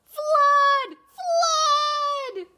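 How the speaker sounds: high, loud cry of fire; alarm shout. Acted. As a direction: yell the alarm to scare the birds. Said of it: "he cried".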